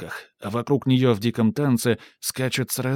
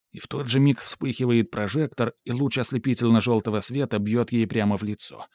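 plain reading voice

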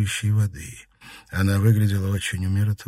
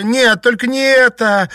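very high, loud cry; piercing denial; shrieking; 9 dB louder than usual. A narrator's reading. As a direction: shriek out in horror. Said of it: "завизжала Наташа".

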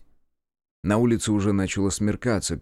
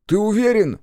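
high, loud check; raised-pitch, startled query; incredulous question; 13 semitones higher, 6 dB louder than usual. A narrator's reading. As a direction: demand confirmation in disbelief. Степ doubts the deaths.

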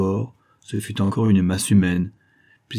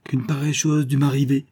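higher, startled cry of disbelief; stunned at his own deed; the shaken scientist marvelling aloud; astonished exclamation; 6 semitones higher than usual. Acted. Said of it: "s'écria-t-il".

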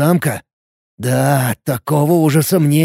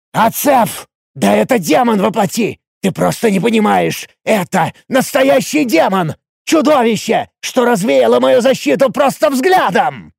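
high, frantic angry insistence; яростно; 8 semitones higher than usual. A narrator's reading. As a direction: make him grasp the danger, voice raised high. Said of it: "захлёбываясь от ярости, рычал я". (яростно, почти срываясь на крик)

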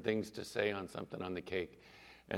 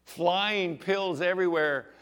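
ordinary delivery

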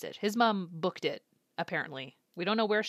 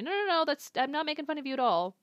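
higher, louder hurried denial; lightly imitating a flustered backpedal; 7 semitones higher, 4 dB louder than usual. Lightly voiced Arata backpedaling to Katawaki, flustered. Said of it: "has to backpedal".

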